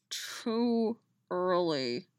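muttered sleepily. A narrator's reading. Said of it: "Dolls muttered in her ear".

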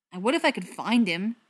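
loud, pointed retort; irritated comeback. A narrator's reading. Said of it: "the butcher retorted".

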